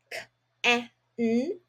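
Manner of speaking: K, eh, n, sound by sound; sounding out can. Shown as a demonstration. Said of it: "read the first word first".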